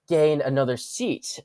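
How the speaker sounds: ordinary delivery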